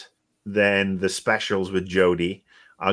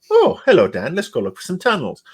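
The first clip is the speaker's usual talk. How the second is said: a light, higher put-on voice for the Doctor; mock-cheerful Doctor, much higher on the hello; mock Doctor greeting; 7 semitones higher, 3 dB louder than usual. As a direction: breezily greet Dan in a hammed-up voice. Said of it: "bump into Dan and go".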